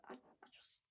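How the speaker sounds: hushed, faltering, almost voiceless apology; whispered apology. Acted. Losing the thread of what to say, whispered apology. Loses her thread mid-monologue.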